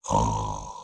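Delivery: a slow breathy snore out through the mouth; snoring breath. Shown as a demonstration. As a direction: breathe out like a sleeping bee snoring. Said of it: "we're going to make a snoring sound".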